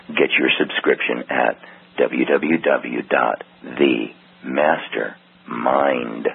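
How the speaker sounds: normal talking voice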